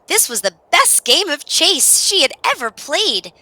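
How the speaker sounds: high, loud storyteller excitement; delighted. A narrator's reading. Narrator sharing the dog's joy at playing chase, delighted. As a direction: burst with delight over the game.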